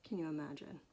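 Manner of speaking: plain reading voice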